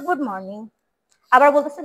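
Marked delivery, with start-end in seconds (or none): 0.1-0.6 low, dull, lifeless good morning; flat mumbled greeting. Shown as a demonstration. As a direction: say গুড morning without any feeling, dropped low and soft. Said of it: "কোনটা ভালো, কোনটা সুন্দর?". (প্রাণহীন, নিচু গলায় গুড morning)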